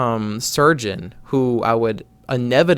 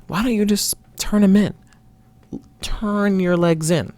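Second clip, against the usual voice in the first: higher, slowed hint of the coach's thick accent; old Scottish coach; 8 semitones higher than usual. Old coach telling the boy to turn his legs in, half-done imitation. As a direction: lightly mimic the old coach correcting him. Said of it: "he had a thick accent".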